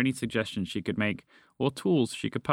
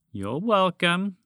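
high, slow, lilting with a rise; snake prompting thanks; 6 semitones higher, slower than usual. A narrator's reading. Snake to the frog it freed, slow and pointed. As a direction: prompt pointedly for gratitude, slowly.

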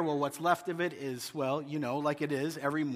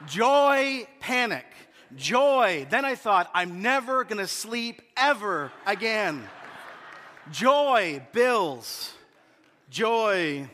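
excited; 3 semitones higher, 4 dB louder, slower than usual. Retold or imitated. Retold shock of pregnancy news.

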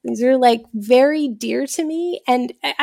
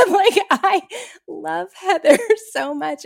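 higher, slow, laughing declaration; 7 semitones higher than usual. Real, not acted. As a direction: gush about it through a laugh. Laughing confession of love.

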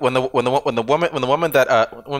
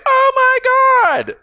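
ecstatic squeal; 7 dB louder, much slower than usual. Retold or imitated. Room loses their minds.

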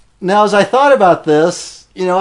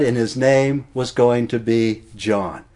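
usual speaking voice

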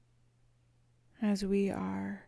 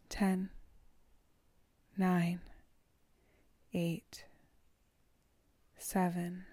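slow soothing countdown; slower than usual. Acted. Counting down to relax.

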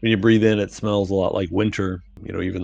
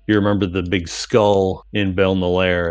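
ordinary delivery

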